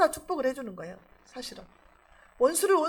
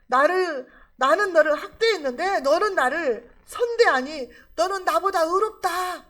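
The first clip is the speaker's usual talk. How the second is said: raised, high-pitched acted confession; impassioned confession; 5 semitones higher, 5 dB louder than usual. Saul admitting David is more righteous, in a loud, impassioned imitation. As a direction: proclaim it loudly, imitating an overwhelmed king. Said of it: "그렇게 말하는 거예요".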